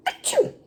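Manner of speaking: high, explosive achoo; big sneeze. Acted. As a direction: let out a huge sneeze. Gerald sneezes.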